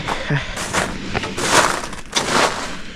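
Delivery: soft, drawn-out exhale of dismay; weary sigh. Real, not acted. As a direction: sigh it out, deflated. Winded, upset about the crash.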